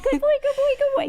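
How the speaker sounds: squeaky high praise for a dog; sing-song baby talk to a good dog; high pitched voice. Retold or imitated. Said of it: "talk in your high pitched voice".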